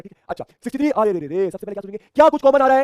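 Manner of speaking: ordinary talk, nothing marked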